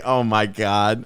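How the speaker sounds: slow, drawn-out oh my God; imitating shocked disbelief, slowly; stunned mock disbelief. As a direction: act floored by the comment. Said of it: "And I was like".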